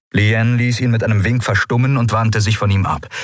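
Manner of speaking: usual speaking voice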